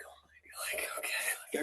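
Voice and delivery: put-on whispering like a kid bracing himself; hushed mock dread, under the breath; imitated whispered panic. Coach imitating a kid facing three minutes still, whispered dread.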